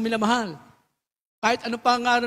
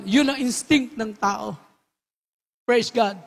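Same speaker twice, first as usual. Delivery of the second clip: ordinary talk, nothing marked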